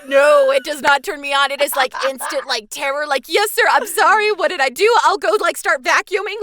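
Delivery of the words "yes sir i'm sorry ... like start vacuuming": much higher and louder, scrambling to please; frantic soldier snapping to attention; mock terrified obedience. Terrified by dad voice.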